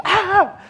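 sudden high, loud shriek; imitating a startled wife's shriek; mock panicked yelp; startled scream. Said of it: "She goes into a panic".

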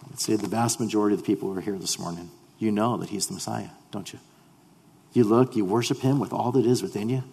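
ordinary delivery

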